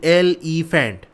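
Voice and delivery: "Elephant" said slowly as एल, ई, faint; slow, segmented "elephant" for learners; broken into syllables. Shown as a demonstration. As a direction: leave a small break between the parts of "elephant". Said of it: "थोड़ा सा हम break दे के पढ़ें".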